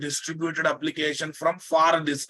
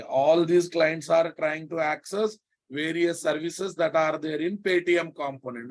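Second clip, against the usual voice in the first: ordinary talk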